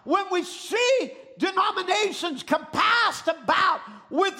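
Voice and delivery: high, shouted revival preaching; full-force preaching. Real, not acted. Preacher warns the church to flee dead religion, shouted preaching. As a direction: drive each phrase out to the congregation.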